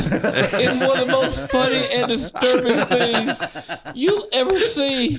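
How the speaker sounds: high, laughing disbelief. Real, not acted. Comic cracking up retelling the drunk friend's stunt. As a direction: laugh through it, high and delighted. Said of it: "laughing while describing the act".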